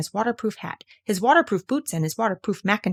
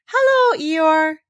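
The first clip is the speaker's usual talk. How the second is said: very high, loud bright greeting; beaming at the donkey; cheery hello; 9 semitones higher, 7 dB louder, much slower than usual. Acted. Pooh greets Eeyore, high and cheery.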